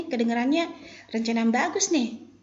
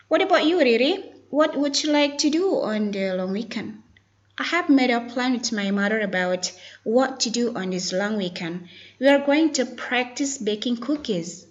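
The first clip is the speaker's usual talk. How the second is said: careful, raised-pitch pronunciation demo; model dialogue reading; 5 semitones higher, 5 dB louder than usual. Shown as a demonstration. Teacher models a weekend dialogue for students, careful and raised. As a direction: read it out clearly for learners, with marked intonation. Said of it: "focus to pronunciation and intonation".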